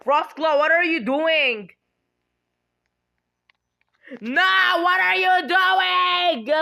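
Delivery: high, loud disbelieving shriek; shocked yell. Real, not acted. Player shrieks at Frostclaw in shocked disbelief.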